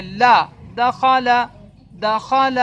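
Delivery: لا then دخل sounded out loud and clear for the pupil; clear letter demo. Shown as a demonstration. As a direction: say دخل slowly and distinctly like a teacher. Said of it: "پھر لا کی آواز بولنی ہے".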